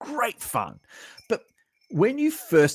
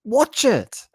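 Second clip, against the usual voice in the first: enthusiastic recommendation; 11 semitones higher, 3 dB louder, much slower than usual. Retold or imitated. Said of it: "You say".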